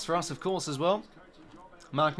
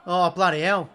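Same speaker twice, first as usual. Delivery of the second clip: higher, louder outcry at the play; loud protest; 3 semitones higher, 8 dB louder than usual. Real, not acted. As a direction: call out the foul in exasperation.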